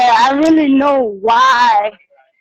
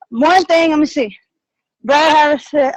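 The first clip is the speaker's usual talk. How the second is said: ordinary talk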